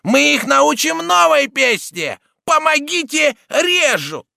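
high mocking cries, loud and drawn out; Цибуля shouting. A narrator's reading. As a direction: yell a jeering mock cry for help. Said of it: "кричала Цибуля".

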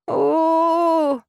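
high, whining creature howl; whiny drawn-out wail. A narrator's reading. Rabbit wailing in frustration, whiny and drawn out. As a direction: stretch a tearful whimper. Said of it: "протянул плаксивое".

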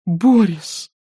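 high tender woman's voice. A narrator's reading. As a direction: say his name gently, caring. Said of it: "ласково сказала Катя".

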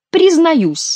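high, nasal mock confession; hammed-up nasal penitent; nasal whine. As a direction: whine it high through the nose. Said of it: "загнусавил Шут".

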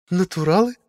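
high, thrilled mannered exclamation; delighted. A narrator's reading. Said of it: "восхитилась услышанному Василиса".

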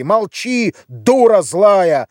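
high opening, slow loud insult; angry shout. A narrator's reading. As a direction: silence them, leaning hard on each word. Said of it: "кричал Гудаевский". (злой окрик, с растяжкой)

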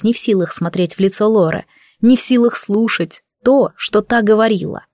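ordinary delivery

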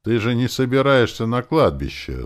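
high, loud scornful retort; contemptuous. A narrator's reading. Hero scorns the begging zombie, contemptuous. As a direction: say it with open disdain. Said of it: "сказал я с презрением".

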